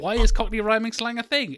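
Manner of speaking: high, exasperated mock disbelief; baffled outburst. Acted baffled outburst at rhyming slang.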